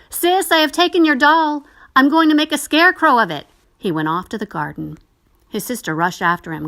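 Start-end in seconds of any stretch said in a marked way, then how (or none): 0.1-3.5 put-on much higher, louder boy's taunting call. A narrator's reading. Boy calls out to his sister, taunting and much louder. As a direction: shout it across the house to tease. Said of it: "And calling out".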